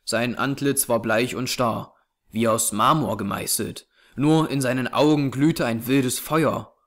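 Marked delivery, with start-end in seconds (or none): none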